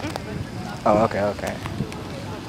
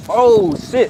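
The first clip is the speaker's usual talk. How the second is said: normal talking voice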